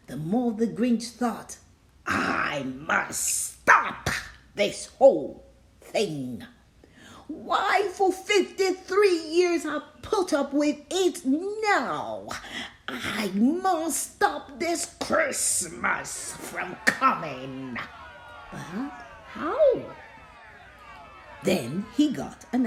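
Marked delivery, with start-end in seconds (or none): 2.1-6.4 low sneering Grinch, dragging the words; a mean green grump muttering with scorn. Acted. Grinch spying on the Whos, sneering low. Grinch plotting, slow then whispered. 7.3-17.8 fed-up Grinch, high and exasperated; fifty-three years of noise boils over; Grinch outburst. Acted. Grinch ranting down at Whoville, exasperated. 18.5-20.0 a mean green grump muttering with scorn; Grinch voice. Acted. Grinch puzzling.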